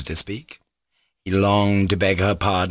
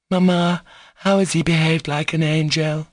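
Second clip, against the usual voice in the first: put-on higher voice of a girl calling out; insistent girl; 8 semitones higher than usual. Lisa questions Mamma from behind the door, insistent. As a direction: butt in with a raised, girlish voice. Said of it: "Lisa's voice was heard again".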